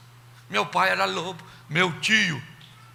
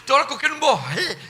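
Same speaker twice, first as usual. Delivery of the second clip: lightly voiced whiny teen, higher; whiny girl; 5 semitones higher than usual. Daughter whining to her mother, mock self-pity. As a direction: complain like a trapped girl.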